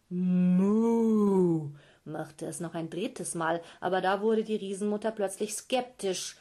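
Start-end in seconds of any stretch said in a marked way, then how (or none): 0.1-1.7 calf moo. Acted. Calf in the sack moos at the giant mother.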